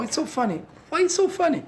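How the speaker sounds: normal talking voice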